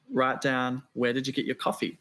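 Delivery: ordinary delivery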